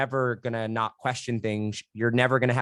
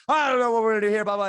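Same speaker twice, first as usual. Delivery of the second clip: high-pitched, babbling panic; mock freak-out; 8 semitones higher, 3 dB louder, faster than usual. Husband panicking to his partner, mock freak-out. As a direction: act out a spiraling panic, loud and high. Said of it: "like, freaking out".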